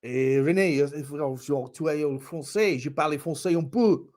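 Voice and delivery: badly faked French accent. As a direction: speak halting schoolboy French. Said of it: "I think you're French".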